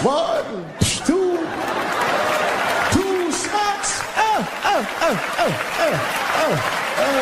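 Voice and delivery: the Count relishing each smack; Count's counting cackle. Pretend. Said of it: "They called him the Count".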